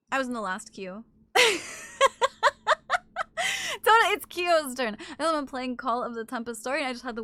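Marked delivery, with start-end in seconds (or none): 1.3-3.8 a burst of high-pitched laughter; loud high laugh. Real, not acted. Laughing at a chatter's joke about the last queue, a loud burst of laughter. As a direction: crack up loud.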